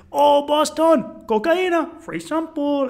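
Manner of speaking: high, loud, drawn-out tout call; Colombian street hustler. Retold or imitated. Hustler spotting the tourists again, high imitated call. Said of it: "Guy finds us, us again on the street".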